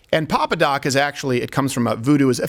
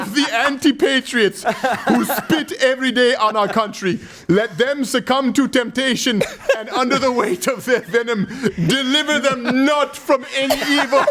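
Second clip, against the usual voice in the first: laughing through the prayer; 8 semitones higher than usual. Retold or imitated. A host reads the dictator's prayer, cracking up. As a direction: intone it like church while giggling. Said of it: "I gotta read this".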